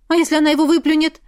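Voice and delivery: quick loud worried question; anxious. Acted. Mother fears the girl will spit out the potion, anxious. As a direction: rush it out in worry.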